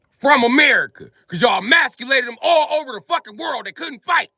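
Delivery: high, loud ranting shout. Real, not acted. Ranting to the camera about who saved the world, in a high loud shout. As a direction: yell it out in outrage. Heated rant peak.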